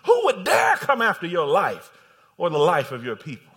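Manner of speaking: loud, indignant demand building then easing; preacher acting out royal anger; angry king. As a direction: challenge them as an outraged king. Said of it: "And the king was angry then".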